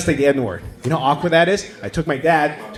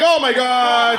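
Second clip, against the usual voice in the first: horrified; 9 semitones higher, much slower than usual. Retold or imitated. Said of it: "I was like".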